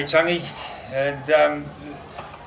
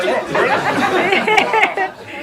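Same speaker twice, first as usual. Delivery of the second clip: very slow, drawn-out high mock surprise with a laugh; 4 dB louder, much slower than usual. Friend teasing grumpy Jono, drawn-out high mock surprise. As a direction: stretch it out, teasing disbelief. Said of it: "And you go".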